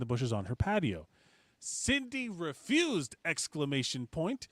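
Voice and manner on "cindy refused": mock outrage. Reading post with exclamation point.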